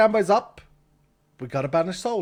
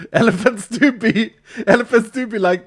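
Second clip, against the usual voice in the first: laughing; 5 semitones higher, 8 dB louder than usual. Real, not acted. Laughing at joke.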